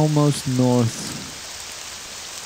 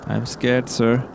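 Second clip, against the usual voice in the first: ordinary talk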